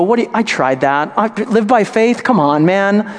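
mocking friend. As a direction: sneer like a doubting friend. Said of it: "They'll go".

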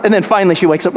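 usual speaking voice

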